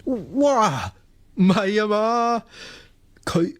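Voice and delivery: high startled gasp of disbelief; can't believe who just wrote to him; shocked disbelief. Acted. Student sees who sent the message, high shocked disbelief.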